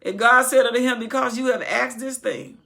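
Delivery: loud sermon reading; booming pulpit reading; preaching scripture. Acted. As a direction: proclaim the verse. Reading scripture at full force.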